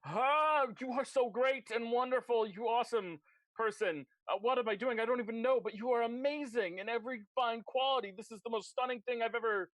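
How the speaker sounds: high-pitched, over-the-top gushing praise; groveling. Acted. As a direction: fawn helplessly, hammed up. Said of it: "groveling. He's like".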